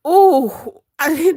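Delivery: ordinary talk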